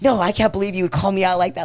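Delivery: high, loud indignant protest; retold anger. Mom fighting with her teenage daughter, retold anger. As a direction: re-enact an outraged telling-off. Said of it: "I was so mad".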